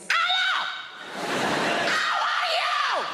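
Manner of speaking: very high, loud shouted greeting, Spanish mum. Retold or imitated. Spanish mum greeting people at full volume. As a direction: blast the greeting at one volume. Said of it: "She's got one volume. It's like this".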